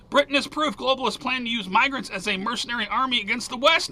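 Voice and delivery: ordinary talk, nothing marked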